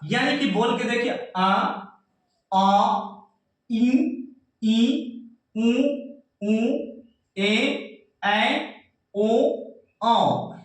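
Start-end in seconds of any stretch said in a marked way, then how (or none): 1.4-10.5 each vowel held separately, with a pause after it; vowel sound demonstration. Shown as a demonstration. Teacher shows students the vowel sounds, slightly stressed demonstration. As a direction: sound out आ, इ, ई, उ, ऊ one at a time. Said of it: "यानी कि थोड़ा सा यहाँ पे जोर लग रहा है".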